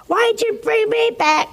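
high, loud complaint; high, loud protest at the doctor; indignant protest. Retold or imitated. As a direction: demand it like you've been cheated. Angry man on operating table.

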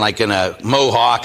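usual speaking voice

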